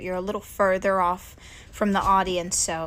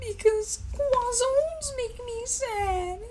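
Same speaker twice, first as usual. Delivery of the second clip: much higher, slow squeaky cartoon boy; Carl Wheezer impression; much slower than usual. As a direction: squeak it out like a nerdy cartoon kid. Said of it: "I can't do that impression".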